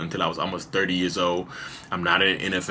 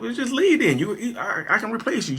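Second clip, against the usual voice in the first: mock-angry comeback, pitched way up; heated retort. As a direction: fire it back at your wife, much higher. Heated quarrel with wife retold.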